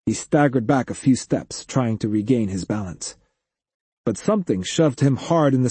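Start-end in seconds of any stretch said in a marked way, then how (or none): none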